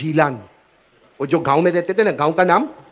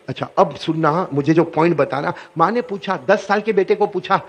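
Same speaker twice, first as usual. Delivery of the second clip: ordinary talk, nothing marked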